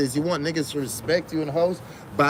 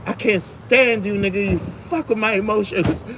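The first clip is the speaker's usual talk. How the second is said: higher, louder mock girlfriend complaint; imitating his girlfriend cussing him out; mock upset girlfriend; 6 semitones higher, 3 dB louder than usual. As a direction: play the fed-up girlfriend, half-mocking. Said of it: "She gonna be like".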